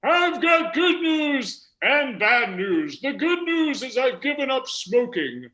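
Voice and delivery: high, slow centenarian voice; very old man. Acted. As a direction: drag out the words in a high-pitched old man's voice. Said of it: "The hundred and two-year-old man said to his wife".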